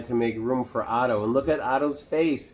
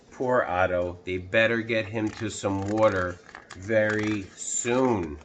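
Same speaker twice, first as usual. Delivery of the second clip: ordinary delivery, nothing marked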